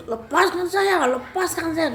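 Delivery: very high, urgent cry for release; berontak minta dilepas. Retold or imitated. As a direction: act a held spirit struggling to get free. Spirit struggling in his grip. (berteriak minta dilepas, melengking tinggi)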